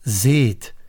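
ordinary delivery

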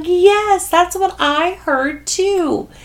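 laughing. Retold or imitated. Elderly friend laughs at Teresa on the phone, high delight. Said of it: "she starts laughing and she's like".

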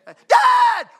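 high, loud, excited "Dad!"; eager child shout. Retold or imitated. Preacher voicing an excited son yelling "Dad!". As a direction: yell "Dad!" like an excited kid. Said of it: "it's a difference".

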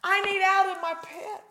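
high, loud plea to God; crying out. Acted. David calling to God from his pit, crying out. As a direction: cry up to heaven from the pit. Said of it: "He cried out".

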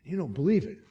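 usual speaking voice

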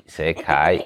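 usual speaking voice

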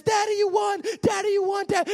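put-on high, thrilled kid's cry; excited child shout. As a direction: squeal it like a winning child. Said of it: "my daughter says".

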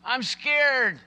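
imitating a frightened little girl, high and loud; acted high-pitched cry of fright; scared child's cry. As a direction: call out for Daddy like a scared child. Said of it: "she cried out".

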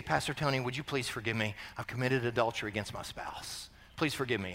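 very low, hushed plea; imitated confession. Congregant confesses adultery to pastor, quiet retold plea.

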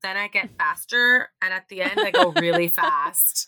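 imitating his slow, measured answer; slow, deliberate quote. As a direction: draw each step out, quoting the date. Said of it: "so this is his response".

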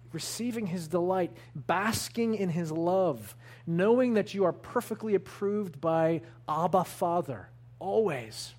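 ordinary talk

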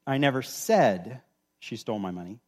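"said" stretched long, then a pause; stress on SAID. Shown as a demonstration. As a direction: lean on "said" and hold it before going on. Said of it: "emphasize a different word every time".

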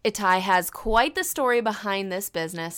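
ordinary talk, nothing marked

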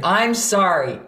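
punchy, fiery mock apology. Shown as a demonstration. Teacher mimicking apology we've all heard, fiery. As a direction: fire the apology out insincerely. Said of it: "in a nice fiery voice".

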